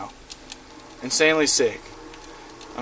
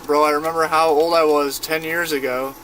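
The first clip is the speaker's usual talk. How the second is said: normal talking voice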